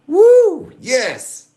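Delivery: loud high whoop; real excited whoop. Real, not acted. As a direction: let out a thrilled whoop. Celebrating the coin find.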